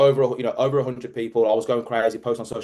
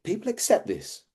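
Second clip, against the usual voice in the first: high, softer disbelief; incredulous; 9 semitones higher, 5 dB quieter than usual. Retold or imitated. Speaker marvelling at what workers put up with, incredulous. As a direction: pitch jumps up in appalled wonder.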